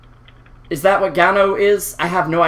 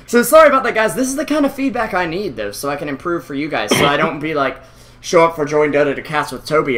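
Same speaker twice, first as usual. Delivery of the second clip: ordinary talk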